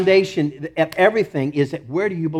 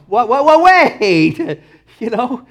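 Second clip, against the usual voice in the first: high-pitched mock protest; hammed-up, raised-voice objection; Paul; 5 semitones higher than usual. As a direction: plead comically against bad news. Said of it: "you can imagine Paul going".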